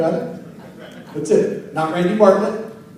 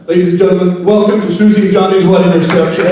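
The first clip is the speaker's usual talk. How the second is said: louder MC welcome; bigger announcement; 2 semitones lower, 6 dB louder than usual. Shown as a demonstration. Emcee retrying the reception welcome, louder. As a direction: sell the welcome to the crowd. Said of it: "let's see if it does any better this time".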